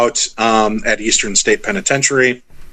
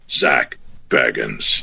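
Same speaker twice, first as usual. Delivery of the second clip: very low, slow spirit-box voice; ghostly voice; 3 dB quieter, much slower than usual. Retold or imitated. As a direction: intone it like a ghost on a recording. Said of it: "you hear the voice go".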